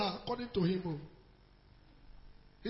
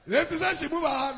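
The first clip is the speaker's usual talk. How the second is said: higher and louder, pressing the point; emphatic repeat; 4 semitones higher, 5 dB louder than usual. Real, not acted. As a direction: say it again, hammering it home.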